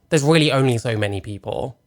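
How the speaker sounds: ordinary talk, nothing marked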